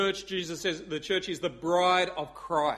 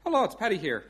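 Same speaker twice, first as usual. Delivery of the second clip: higher-pitched Irish caller; Irish accent; 4 semitones higher than usual. Acted. Paddy ringing North Korea, Irish accent. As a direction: greet them in an Irish accent. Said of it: "I do do an Irish accent".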